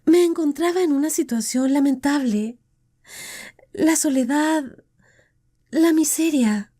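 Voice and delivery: pleading woman. Acted. Deirdre explains to Tim why she married, remorseful. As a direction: confess her weakness, pleading. Female character voice held.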